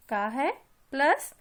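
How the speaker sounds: ordinary talk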